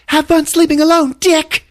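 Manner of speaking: very high, loud acted yell; angry parting shout. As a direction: yell it as you slam the door. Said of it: "slamming the door and going".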